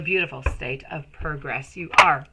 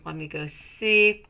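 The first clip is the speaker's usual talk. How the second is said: usual speaking voice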